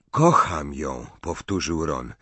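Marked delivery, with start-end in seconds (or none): 0.1-1.1 low, serious declaration; in earnest. A narrator's reading. Boy repeating his love to a friend, in earnest. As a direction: say it low and sincerely. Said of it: "ale na poważnie".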